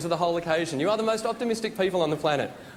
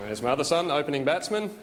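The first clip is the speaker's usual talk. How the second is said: put-on broad laconic Australian accent, lower pitch; Australian bloke; 5 semitones lower than usual. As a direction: play an Aussie dad introducing his kids. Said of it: "imagine an Australian guy naming his kids".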